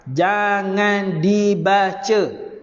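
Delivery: "Jangan dibaca" stretched out slowly, syllable by syllable; emphatic warning. Shown as a demonstration. Teacher stressing a warning. (amaran tegas, "Jangan dibaca" perlahan-lahan)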